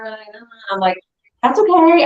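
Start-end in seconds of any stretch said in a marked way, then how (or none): 1.4-2.0 high, louder polite reassurance; acted-out "no worries" lilt; retold reassurance. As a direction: wave off an apology in a high voice. Brushing off friend's apology on phone.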